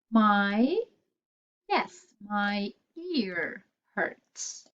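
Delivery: usual speaking voice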